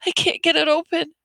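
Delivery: very high, panicked squeal; frantic, high-pitched young girl. A narrator's reading. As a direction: panic as the clasp won't open. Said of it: "Ellen said frantically".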